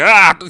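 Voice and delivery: high, loud mock cry of dismay; cartoonish anguished ah. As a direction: yelp like the news just hit. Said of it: "so he actually cries out".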